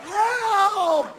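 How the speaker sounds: high, loud cry for help; hammed-up desperate yell; pleading shout. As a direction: beg for help at full voice. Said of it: "And they're going".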